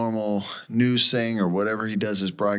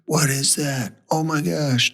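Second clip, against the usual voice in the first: higher-pitched mock astonishment; retold amazement; 7 semitones higher than usual. Driver spots lights and pulls over, retold astonishment. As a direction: act out a startled sighting.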